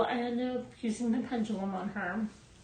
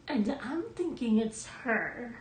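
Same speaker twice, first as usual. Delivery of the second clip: ordinary talk, nothing marked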